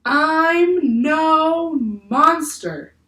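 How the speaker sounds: very slow, high, loud, otherworldly; creature voice. Acted. Octopus tells the staring crew he is no monster, very slowly.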